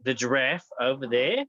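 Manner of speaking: lightly put-on Australian accent as Noah; a hint of Aussie, giving the animals orders; mock Australian accent. Noah sending animals off, in a mild Australian accent. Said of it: "Why are we Australian right now?".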